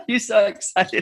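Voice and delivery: laughing tease. Real, not acted. Teasing laughter.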